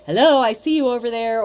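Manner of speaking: a loud taunting hello to someone hiding; mock sing-song greeting. Reader taunts the spell-caster: loud mock greeting. Mocking someone badly hidden.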